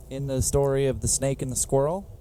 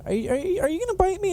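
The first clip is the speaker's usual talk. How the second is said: much higher, worried otter voice; nervous otter; faster than usual. Retold or imitated. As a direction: ask timidly, afraid of being bitten. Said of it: "the otter's like".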